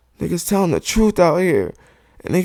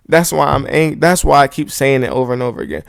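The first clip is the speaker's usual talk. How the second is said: ordinary delivery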